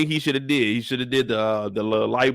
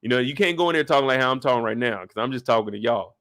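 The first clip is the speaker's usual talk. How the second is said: ordinary delivery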